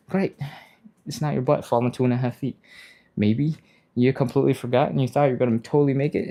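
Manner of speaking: normal talking voice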